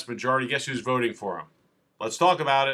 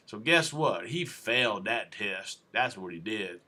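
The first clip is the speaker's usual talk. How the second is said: mock officer in a Southern accent, low and trailing softer; 4 semitones lower, 5 dB quieter, slower than usual. Officer telling the jury he failed, in a mock Southern accent. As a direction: testify like a put-on Southern cop. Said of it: "I shouldn't do that in a Southern accent".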